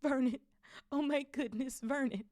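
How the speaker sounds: high, strained gasping cry; about to faint from shock; choking shock. A narrator's reading. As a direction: choke it out high and aghast. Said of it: "made a choking noise".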